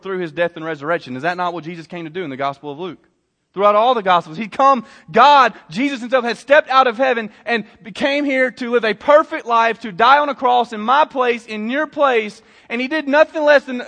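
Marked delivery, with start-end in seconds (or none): 3.5-13.8 high, driving preacher's voice; urgent sermon proclamation; impassioned preaching. Real, not acted. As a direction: build the gospel story phrase by phrase. Preaches the gospel.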